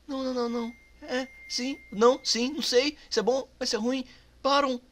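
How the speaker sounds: flustered rambling. Real, not acted. Panicked reaction to game.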